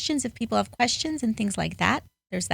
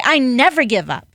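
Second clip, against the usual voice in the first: loud and fierce, pitch raised; with passion; 5 semitones higher, 9 dB louder than usual. Shown as a demonstration. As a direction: proclaim it like a battle cry. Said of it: "You say".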